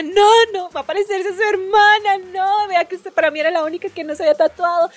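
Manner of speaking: lamento agudo de madre, imitado; súplica casi llorando. Retold or imitated. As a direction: imita a una mamá suplicando al borde del llanto. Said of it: "casi que lloraba y me decía". (berrinche lloroso fingido)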